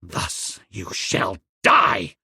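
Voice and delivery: low, slow, menacing voice; slow, low, terrible threat; terrible hiss. A narrator's reading. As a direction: drag it out low and deadly. Tarzan's hissed threat continues.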